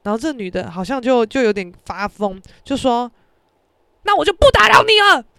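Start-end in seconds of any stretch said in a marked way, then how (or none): 4.0-5.2 尖着嗓子高声喊出来; 赌气地突然拔高大喊; 赌气大叫. Retold or imitated. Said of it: "就突然这样一个大叫". (高亢的赌气大叫)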